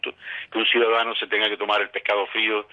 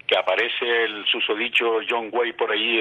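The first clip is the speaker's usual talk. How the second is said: usual speaking voice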